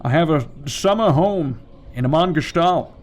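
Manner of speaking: mock Scottish star with sh for s; Sean Connery. Famous actor boasting of a summer home, Scottish impression. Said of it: "maybe it's Sean Connery".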